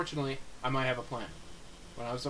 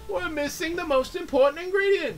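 high, loud, over-the-top TV host; cooking show host voice; 9 semitones higher, 7 dB louder than usual. Pretend. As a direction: gush like a cheesy cooking host. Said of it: "I'm gonna try and do a game show voice".

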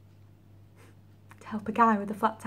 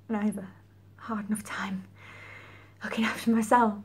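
tragic heroine sorrow. Acted. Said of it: "That's a feeling".

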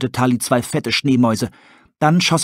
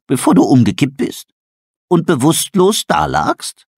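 owl cutting in; 4 semitones higher, 5 dB louder than usual. Acted. Tully interrupting the raving wolf, incredulous. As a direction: cut him off, incredulous.

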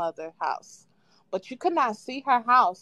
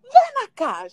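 much higher, louder calling yell; grandma yelling; 13 semitones higher, 7 dB louder than usual. Retold or imitated. Grandma yelling for Yuriko from the house, high and loud. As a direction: shout the name across the yard, high and loud. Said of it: "She's yelling at me".

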